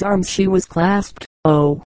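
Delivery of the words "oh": a touch of seasick pleading; mildly plaintive, lightly voiced bride; pathetic murmur. A narrator's reading. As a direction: ask weakly, a little sorry for herself. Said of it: "she murmured pathetically".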